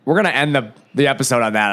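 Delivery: ordinary talk, nothing marked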